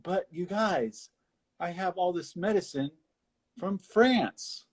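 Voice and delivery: hammed-up salesman; mock salesman pitch. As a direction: sell it like a smooth con man. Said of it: "He's like".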